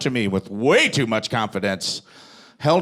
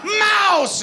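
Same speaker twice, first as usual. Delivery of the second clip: shrill high shout of a name, laughing; high mock shriek, calling out; shrieking lady pirate; much slower than usual. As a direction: screech it like a zany pirate lady calling her hawk. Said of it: "again she goes".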